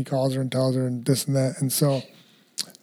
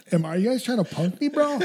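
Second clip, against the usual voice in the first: incredulous; 6 semitones higher than usual. Retold or imitated. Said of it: "I'm like".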